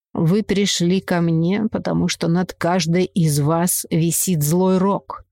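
slow, raspy fortune-teller; hoarse witch voice. Acted. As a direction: rasp it out, slow and ominous. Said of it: "хриплым голосом произнесла ведьма".

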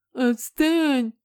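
high, loud whiny taunt; mocking mimicry. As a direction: mimic a crybaby brother mockingly. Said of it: "передразнил Генри". (передразнивая, высоким противным голосом)

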